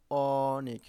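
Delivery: "অনেক" with a long, loud opening ɔ; open ɔ sound. Shown as a demonstration. Teacher showing pupils the vowel অ, drawn out and open. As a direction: stretch the first vowel of "অনেক" as ɔɔ-nek. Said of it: "তাহলে অ উচ্চারণ হচ্ছে অ".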